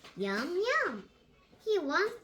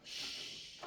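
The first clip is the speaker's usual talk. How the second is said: whispered shush; 15 dB quieter than usual. Acted. Big Brother Pig hushing Little Pig while hiding, whispered shush. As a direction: hush him in a whisper. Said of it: "says Big Brother Pig".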